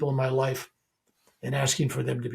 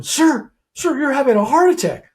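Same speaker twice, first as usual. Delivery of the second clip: high, loud alarmed cry; panicked nurse; 11 semitones higher, 7 dB louder than usual. Retold or imitated. Nurse panics over heart attack.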